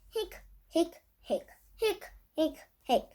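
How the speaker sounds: high little hiccups; hiccup sounds. Acted. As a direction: hic out each one like a hiccup. Skeleton has the hiccups.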